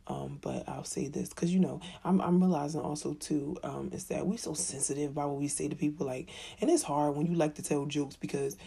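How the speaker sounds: ordinary talk, nothing marked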